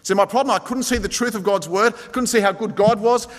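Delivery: ordinary talk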